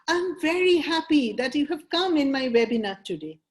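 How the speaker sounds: happy tonality. Shown as a demonstration. Coach welcoming webinar guests with feeling, happy and lifted. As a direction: put real emotion into the welcome. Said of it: "adding your emotion or your mood".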